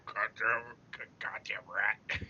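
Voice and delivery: low, slow whispered goblin grumble. Acted. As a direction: mutter like an annoyed goblin. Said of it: "that's a goblin voice".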